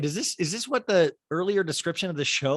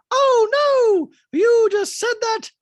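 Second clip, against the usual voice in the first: very high, loud horrified squeal; hammed-up piercing caricature; Dorit; 9 dB louder, slower than usual. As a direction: shriek in mock horror, drawn out. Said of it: "And Dorit goes".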